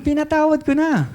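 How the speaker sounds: high, comic grudging pardon; mock bishop. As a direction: squeak out the reluctant forgiveness. Repeats bishop's line comically. (mataas na pabirong boses ng obispo)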